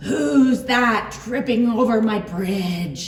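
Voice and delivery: loud storybook roar; troll's roar. A narrator's reading. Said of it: "roared the troll".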